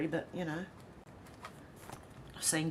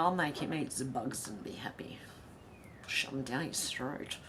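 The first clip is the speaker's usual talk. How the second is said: mock menacing whisper; 4 semitones lower, 7 dB quieter than usual. Host jokingly threatening a chat viewer, in a whisper. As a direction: whisper it like a playful villain.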